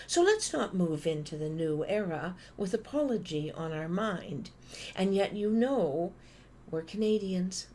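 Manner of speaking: ordinary talk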